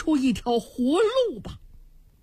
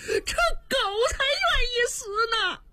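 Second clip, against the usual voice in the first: earnest oath; 13 semitones higher than usual. Acted. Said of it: "举着手对天发誓".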